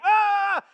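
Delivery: loud, high imitated yell; wordless holler, imitating the angry gym guy; mock angry yell. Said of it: "he's yelling over my head at this guy".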